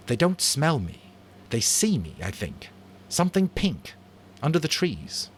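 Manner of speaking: lightly voiced boy hunter, keen; eager boy Jack.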